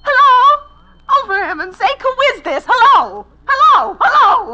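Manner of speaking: high, loud, near-sobbing calls; a desperate wife calling into a silent phone; frantic hello. Acted. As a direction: shout down the line, panicking.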